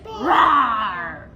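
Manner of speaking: long, drawn-out playful roar; bear roar. Shown as a demonstration. Teacher showing what bear says, playful roaring. Animal sounds for children.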